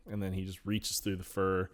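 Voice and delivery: ordinary delivery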